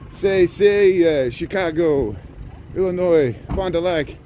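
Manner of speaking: halting put-on French accent. Telling viewers this is Chicago, in halting mock French. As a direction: stumble through it in playful French. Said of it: "We have to say it in French".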